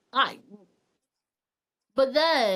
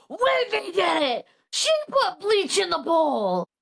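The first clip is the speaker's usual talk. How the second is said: high, insistent mock accusation; a hammed-up accusing husband; frantic accusation; 7 semitones higher, 3 dB louder than usual. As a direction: point the finger, alarmed and sure. Husband accusing in retold story.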